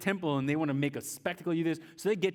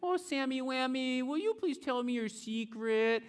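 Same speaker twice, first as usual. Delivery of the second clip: Delilah pleading; 9 semitones higher than usual. Acted. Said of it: "She's like".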